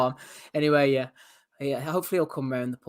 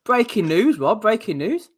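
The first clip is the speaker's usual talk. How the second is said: mock news announcer; 7 semitones higher, 5 dB louder, slower than usual.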